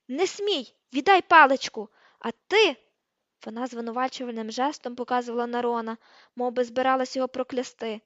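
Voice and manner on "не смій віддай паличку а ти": very loud, high-pitched snapped orders; furious yelling. A narrator's reading. Hermione yelling for her wand, furious. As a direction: yell each order in fury. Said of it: "репетувала вона".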